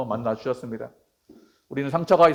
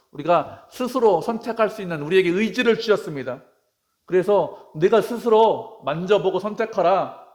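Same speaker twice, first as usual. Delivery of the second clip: higher-pitched, emphatic sermon delivery; forceful preaching; 5 semitones higher, 6 dB louder than usual. Real, not acted. Preacher urging believers to touch the wounds themselves, forceful preaching. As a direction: proclaim it with driving, raised voice.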